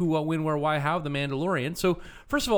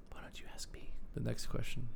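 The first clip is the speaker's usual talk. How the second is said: whisper; 3 semitones lower, 11 dB quieter than usual. Real, not acted. Aside to her co-host.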